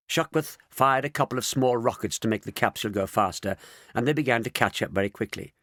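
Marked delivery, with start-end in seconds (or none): none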